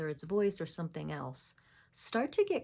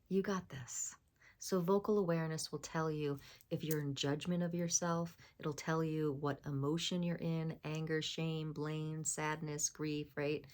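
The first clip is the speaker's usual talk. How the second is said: ordinary talk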